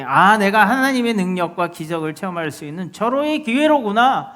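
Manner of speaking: high, loud, expectant proclamation; declaration of faith. Acted. Preacher voices a believer meeting a storm with hope, excited declaration. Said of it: "하는 여러분들 믿음의 선포를".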